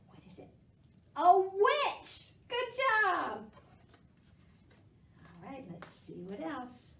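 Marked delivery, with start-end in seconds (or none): 1.1-3.4 high, loud cheer; excited praise. Real, not acted. Teacher cheering kids for guessing right.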